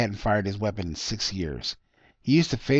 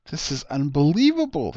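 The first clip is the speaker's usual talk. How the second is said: sighed; 6 semitones higher, 5 dB louder than usual. A narrator's reading. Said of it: "his wife sighed".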